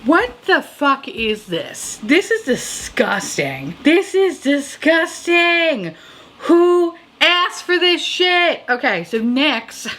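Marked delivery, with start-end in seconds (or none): 0.0-8.6 slow, each word dragged out in disgust; drawn-out disgust. Real, not acted. Reviewer sees an ugly palette, slow appalled disgust. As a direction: drag it out, appalled.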